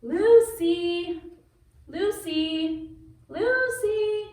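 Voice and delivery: high calling voice, Lucy ringing out. Acted. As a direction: call her name across the house.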